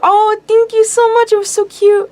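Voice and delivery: overly sweet high thank-you; gushing thanks. Retold or imitated. Acting her gushing thanks.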